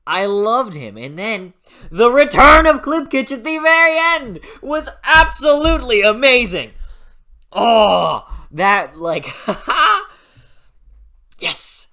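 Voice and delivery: thrilled fan; excited gushing. Real, not acted. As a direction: gush with a laugh at the end. Gushing about character's return.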